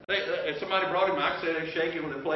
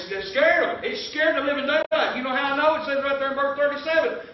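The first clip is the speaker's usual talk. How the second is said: usual speaking voice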